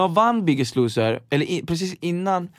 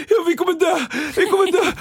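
panic; 4 dB louder than usual. Retold or imitated. Panic on the free fall ride.

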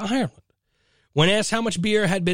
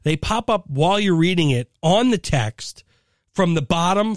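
ordinary talk

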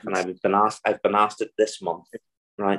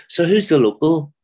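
put-on higher, louder wee old lady voice; mock old woman; 6 semitones higher, 6 dB louder than usual. Landlady quizzing the reporters, mock old lady voice. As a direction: pipe up like a curious old landlady.

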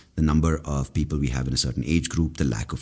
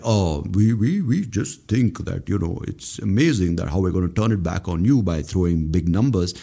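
mock evasive official; 2 semitones higher than usual. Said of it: "this fucking evasive bullshit".

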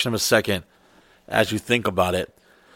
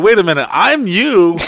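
raised, louder exasperated protest; mock scolding; 8 semitones higher, 6 dB louder than usual. Telling off a distracting friend, mock exasperated. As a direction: protest loudly in a higher voice.

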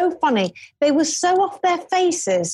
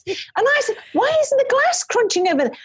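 higher, baffled asking; mock bewilderment; puzzled question; 8 semitones higher than usual. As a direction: act confused about the glass. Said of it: "And I'm like".

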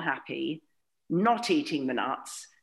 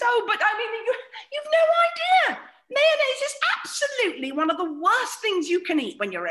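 high, loud fussing posh mother; mother's voice; 3 dB louder than usual. Retold or imitated. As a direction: squeal it like a fussing mother scolding. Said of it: "She goes".

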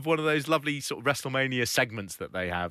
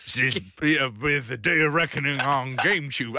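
wrestling promo; 4 dB louder than usual. Retold or imitated. Mock TV bumper.

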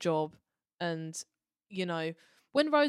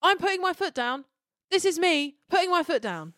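higher, louder mock-stern dad; imitating Martha's dad; 10 semitones higher, 8 dB louder than usual. Fan imitates Martha's dad laying down the law, mock-stern. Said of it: "dad at the end going".

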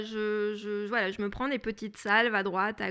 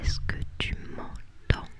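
asmr whisper. Pretend. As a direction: whisper the question intimately. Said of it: "introduction A S M R".

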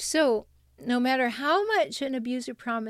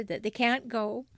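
ordinary talk, nothing marked

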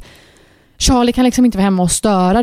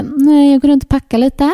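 mock chill; 4 semitones higher than usual. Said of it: "du är så chill".

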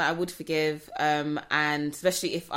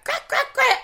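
mock slapping sounds; 8 dB louder than usual.

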